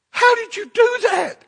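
very high, overcome voice, imitated; imitated bawling woman. Woman seeing the van full of toys, imitated bawling. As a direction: pitch it way up as a tearfully amazed woman. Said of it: "she just starts bawling".

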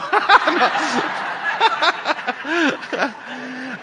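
high, drawn-out laugh at own joke; long hearty laugh. Real, not acted. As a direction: burst out laughing, then let it trail.

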